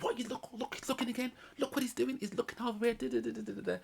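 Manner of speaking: squeaky mock outrage at being looked at; mocking high whiny voice. Mimicking the couple with a high, whiny complaint. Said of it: "And then, like".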